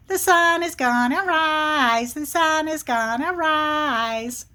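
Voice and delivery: teasing little squirrel chant; smart-alecky squirrel. Acted. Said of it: "the squirrel keeps saying".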